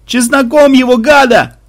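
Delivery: high, loud mob cries; panicked battle yells; yelling. A narrator's reading. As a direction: call frantically for weapons. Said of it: "завопили священники".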